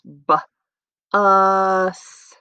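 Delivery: "B, us" split after the B, vowel stretched; segmented word. Shown as a demonstration. Teacher showing three sounds in bus, segmented word. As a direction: sound out "B, us" slowly with a gap after B. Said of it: "I hear three sounds in the word bus".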